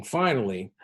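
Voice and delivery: ordinary talk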